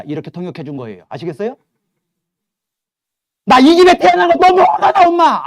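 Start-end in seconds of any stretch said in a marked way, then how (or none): 3.5-5.4 put-on high, loud shriek of a furious child; angry child yelling. Teen yelling at her mother that she hates home, high furious shriek. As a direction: scream it at mom like a hurt teen.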